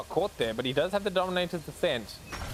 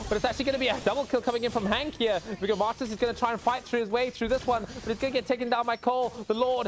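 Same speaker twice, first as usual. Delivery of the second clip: fast, higher live play-by-play; esports caster; 8 semitones higher than usual. Real, not acted. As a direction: rattle off the fight as it happens. Fast play-by-play of team fight.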